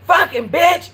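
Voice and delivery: a quick high angry snap, imitating the neighbor; neighbor cussing. Neighbor cussing out the narrator, high mocking mimic. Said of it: "cussing me out like".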